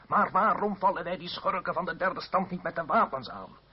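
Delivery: half-acted bellow of an outraged aristocrat; retold roar. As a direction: play the angry noble roaring in the assembly. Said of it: "en brulde".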